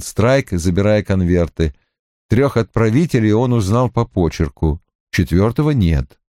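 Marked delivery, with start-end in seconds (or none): none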